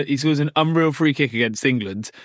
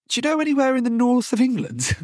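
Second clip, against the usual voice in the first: high, put-on voice of an eager player; a high mock voice of a young Brazilian player; naive young player; 10 semitones higher than usual. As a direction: ask it like an innocent young footballer. Player asking agent about clubs.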